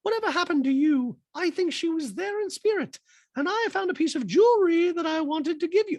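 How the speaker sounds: high mock mother's thanks; Rachel high voice. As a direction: coo it back in a lilting high voice. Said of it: "and Rachel's like".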